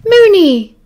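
loud, high startled cry; caught by surprise. A narrator's reading. Sirius caught behind the tapestry by Remus, startled. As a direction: call out the nickname high and loud. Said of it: "Sirius said".

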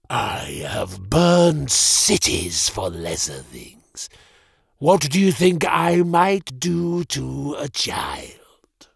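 slow, breathy threatening whisper; menacing whisper. Acted. Mage threatens the child in a slow whisper. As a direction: hiss the threat close to his ear.

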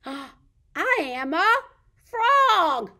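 loud, slow, high reveal; reading to children. Pretend. Revealing the frog to toddlers, big excited read-aloud. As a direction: leap up on the answer.

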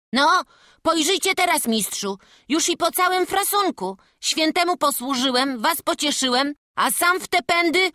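put-on high, pleased boy calling down; triumphant boy. Boy tells master the job is done, triumphant. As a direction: show off the finished job proudly.

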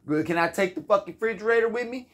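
high, fast indignant retort; hammed-up fast outrage; mock angry demand. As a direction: rattle it off again, outraged. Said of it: "That's probably what I said right there".

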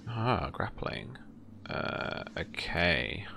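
ordinary delivery

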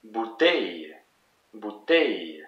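French "bouteille", long falling "teille"; last-syllable stress. Shown as a demonstration. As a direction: model "bouteille" with the weight at the end. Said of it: "it's the last one that is the most emphasized".

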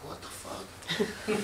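breathless, slow whispered what the fuck; slow stunned whisper; whispered disbelief. Retold or imitated. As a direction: mutter it under your breath, baffled. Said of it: "I was like".

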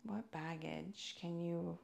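ordinary talk, nothing marked

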